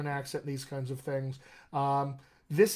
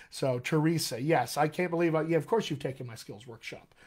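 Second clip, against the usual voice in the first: ordinary talk, nothing marked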